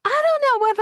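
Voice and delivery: high, sliding Southern indignation; upset Southern woman. Retold or imitated. To the podcast hosts, mimicking an indignant Southern woman, sliding high. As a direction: slide down the keys like an upset Southern lady. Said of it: "upset Southern woman".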